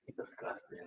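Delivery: hushed grossed-out murmur; disgusted muttering. Retold or imitated. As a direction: recoil in quiet disgust. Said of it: "You gonna be".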